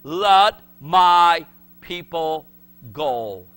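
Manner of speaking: slow, booming decree; God's decree. Acted. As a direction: declare it with finality. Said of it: "he's gonna say".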